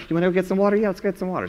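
light high mock ladies' chatter; a hint of chatty village women; ladies chatting. Preacher voicing the ladies inviting each other to fetch water.

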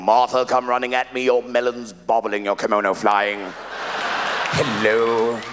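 lightly voiced theatrical leading man; Richard Burton. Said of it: "like Richard Burton coming home".

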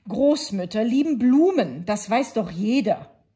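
know-it-all wolf calling; wolf calls out. A narrator's reading. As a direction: announce it as obvious. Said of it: "rief der Wolf".

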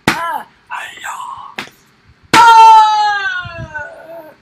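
from a short shout to a full high yell; building battle yell. Real, not acted. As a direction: ramp the yell up to a scream. Fighting, yells building up.

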